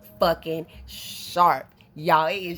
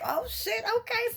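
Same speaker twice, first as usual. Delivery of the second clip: squeaky much higher startled quote; 3 dB quieter than usual. Retold or imitated. Recalling the steep mountain drop, startled much higher squeak. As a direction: yelp it as the mountain drops away. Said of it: "I was like".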